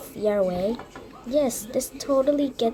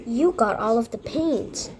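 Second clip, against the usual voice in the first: ordinary talk, nothing marked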